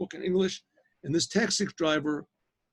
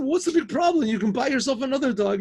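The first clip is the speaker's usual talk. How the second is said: high, raised, exasperated retort; exasperated dismissal; 9 semitones higher, 5 dB louder than usual. Retold or imitated. Professor tells weeping driver to replace dog, exasperated. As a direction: brush the grief off impatiently.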